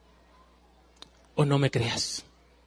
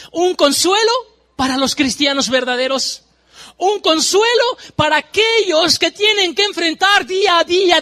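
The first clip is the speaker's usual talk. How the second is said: louder, higher preacher's cry of woe; preaching at full force; 9 semitones higher, 4 dB louder than usual. Acted. Preacher crying woe over those who ignore the eternal, loud and high. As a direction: warn the sinners from the pulpit.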